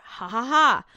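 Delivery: deliberate spelled-out "ha, ha, ha"; mock laugh. Podcaster gloats at thief's bad luck, mock laugh.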